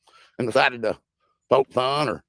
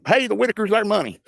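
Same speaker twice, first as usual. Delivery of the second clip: higher-pitched mock holler; 8 semitones higher than usual. Streamer mimicking people hollering about the family's money, mock holler. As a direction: imitate someone hollering out an accusation. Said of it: "If you're gonna holler out".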